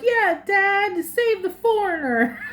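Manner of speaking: high, excited kid voice, a little hammed-up; mock child cheering on his father; imitating an excited little kid. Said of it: "the kid's like".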